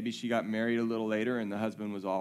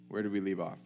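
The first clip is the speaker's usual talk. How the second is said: normal talking voice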